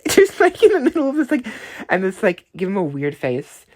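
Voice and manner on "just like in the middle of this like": high, loud laughing. Real, not acted. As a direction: laugh through the words. Laughs recalling weird face.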